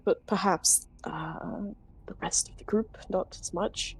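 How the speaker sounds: confidential whisper. Acted. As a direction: lean in and whisper it. Character lowers voice confidentially.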